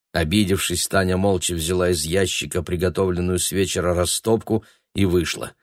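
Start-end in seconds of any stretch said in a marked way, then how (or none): none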